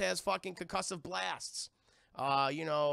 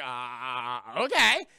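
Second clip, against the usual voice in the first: balk, then agree too loudly; unsure then loud; 7 semitones lower, 8 dB louder, much slower than usual. Retold or imitated. Tudyk unsure about repeating the doctor's phrase, then loud. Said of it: "And Al Tudyk's like".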